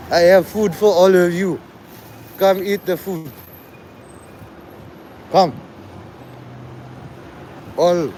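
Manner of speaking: calling out in a put-on African accent; hammed-up African accent, summoning a flock to feed; mock African accent. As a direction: play an African crier calling everyone over.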